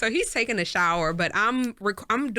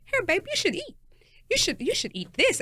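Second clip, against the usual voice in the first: sweet coaxing wife; 10 semitones higher than usual. Retold or imitated. Coaxing husband to eat.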